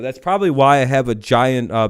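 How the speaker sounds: ordinary delivery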